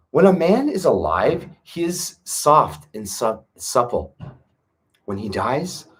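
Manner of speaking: scripture reading. Acted. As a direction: read each line like sacred text, with pauses. Reads scripture aloud.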